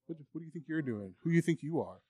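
soft scornful taunt; mocking inner voice. Devil taunting the guitarist, quiet and scornful. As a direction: belittle them under your breath. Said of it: "You're like".

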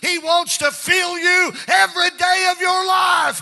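high, sustained revival cry; impassioned preaching shout. Real, not acted. Preacher peaks in exhortation.

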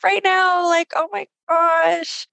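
high, moved outburst of sympathy; a tender cry of compassion; heartfelt sympathy. Real, not acted. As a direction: let it burst out, touched and aching for her. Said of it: "sitting here listening".

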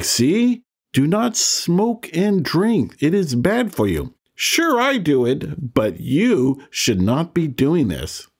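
high, slow mock scolding; lecturing mother. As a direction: imitate a mom laying down the law. Mother's warning voiced in a story.

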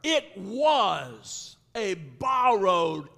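very slow, drawn-out lament; retold lament. Man who lost a borrowed axe, slow lament. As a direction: stretch each word out mournfully. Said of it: "He cried".

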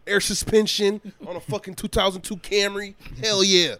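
3 hype up the silly car mod plan; 4 excited bragging; 1 hyped. Real, not acted. Hyping car mods.

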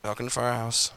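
low, soft, dutiful greeting; a bored staff greeting with no feeling; half-hearted welcome. Retold or imitated. Staff at another store greeting customers, low and half-hearted. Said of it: "they were like".

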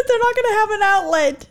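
a hint of a worried little boy; imitating crying boy. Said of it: "And he's like".

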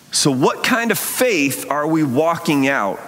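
usual speaking voice

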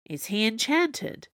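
usual speaking voice

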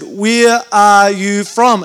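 very slow, each word spaced out; slow, deliberate repeat. Retold or imitated. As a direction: ask as if they don't understand English. Third asking, as if not understood.

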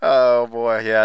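ordinary delivery

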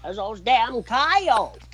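put-on slow, high old prospector. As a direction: drag it out like a grizzled prospector. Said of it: "Old Prospect is the greatest skit".

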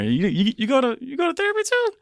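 stammering, high-pitched mock disbelief; incredulous, squeaky replay of surprise; retold bafflement. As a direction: act out being caught off guard, pitch climbing high. Retold surprise at meeting doctor in therapy.

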